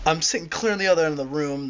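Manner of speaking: ordinary talk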